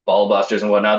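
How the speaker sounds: ordinary talk, nothing marked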